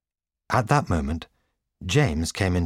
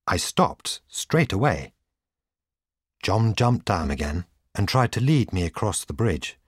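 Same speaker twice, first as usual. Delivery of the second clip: ordinary delivery, nothing marked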